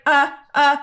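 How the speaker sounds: lightly acted awkward stalling; retold uncomfortable stammer. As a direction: imitate someone flustered and making excuses. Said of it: "he looks wildly uncomfortable".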